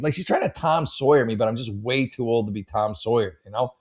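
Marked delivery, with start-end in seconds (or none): none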